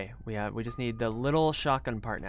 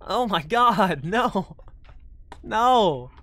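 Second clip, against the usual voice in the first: high, loud disgusted no; loud horrified protest; 6 semitones higher, 9 dB louder, much slower than usual. Real, not acted. Streamer to chat after the donation, loud horrified no. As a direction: cry out in horrified refusal. Reacts to creepy donation message.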